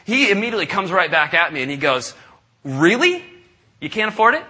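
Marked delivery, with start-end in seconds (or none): 2.6-4.4 higher, mock-incredulous challenge; incredulous pushback. Fireman caller challenging the pastor's excuse, incredulous. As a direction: throw the excuse right back at him. Fireman pushes back at refusal.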